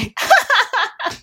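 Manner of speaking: loud real laugh; high laugh. Real, not acted. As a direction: burst of high-pitched laughter. Laughing at the story.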